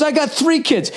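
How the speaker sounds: imitating the smug driver topping his argument; mocking the driver. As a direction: lord it over him in a prissy put-on voice. Retold quarrel, other driver boasting.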